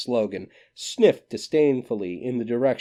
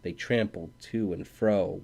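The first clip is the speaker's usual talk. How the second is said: plain reading voice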